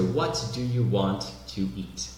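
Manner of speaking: slow "What do you want to eat", each word separate; careful classroom "What do you want to eat"; textbook pronunciation. Shown as a demonstration. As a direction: sound every T in "want to eat". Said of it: "pronúncia certa".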